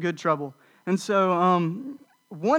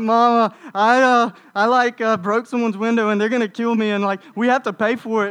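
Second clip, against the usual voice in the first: mock-panicked kid, higher and louder; panicked call to mom; 4 semitones higher, 6 dB louder than usual. Scared boy confessing the broken window to his mom, higher and louder. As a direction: blurt it out like a scared eleven-year-old confessing.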